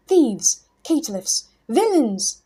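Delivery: loud, high furious scream of a mad hermit; screaming in rage. A narrator's reading. Mad hermit screaming at the thieves in his chamber. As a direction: hurl the insults at the intruders. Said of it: "he screamed".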